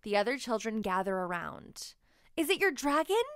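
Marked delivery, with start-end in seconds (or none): 2.4-3.2 eager question. A narrator's reading. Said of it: "asks Willa".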